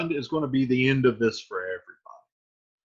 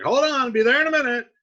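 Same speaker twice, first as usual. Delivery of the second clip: fast, high, loud holler; calling to door; 10 semitones higher, 7 dB louder, faster than usual. Retold or imitated. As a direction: holler hurriedly toward the door. Retold friar calls to the door.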